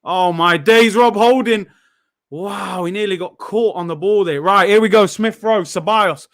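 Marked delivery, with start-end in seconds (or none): none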